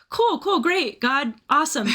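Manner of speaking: high, chirpy, brushing it off; mock eager. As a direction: say cool cool as if impatient to move on. Mock eager reply to God.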